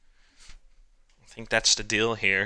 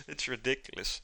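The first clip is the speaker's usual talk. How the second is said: ordinary talk, nothing marked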